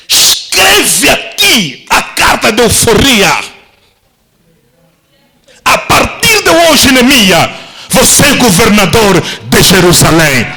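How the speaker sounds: crown him with the words; royal decree. Acted. King proclaims decree.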